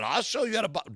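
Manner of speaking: loud high mock threat; taunting threat. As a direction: throw down the challenge. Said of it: "I said".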